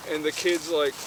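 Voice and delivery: ordinary talk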